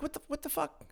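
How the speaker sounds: high, soft incredulous squeak; baffled disbelief. Retold or imitated. Podcaster recalling reaction to people defending this guy, high-pitched bafflement. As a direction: act out stunned confusion in a small, high voice.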